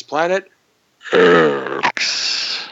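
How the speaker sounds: X as a very low growl; substitute deep voice. Shown as a demonstration. Host shows those who can't get deep, growling the X. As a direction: fake the depth with a long growled X. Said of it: "if you can't get deep, you go like this".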